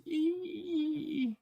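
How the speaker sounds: tiny high squeaky meow; cat meow. Retold or imitated. Imitating Wolfie the cat begging for tuna, high squeaky meow. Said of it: "in his little Wolfie meow".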